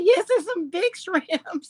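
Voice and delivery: reading comment playfully. Retold or imitated. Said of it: "She said".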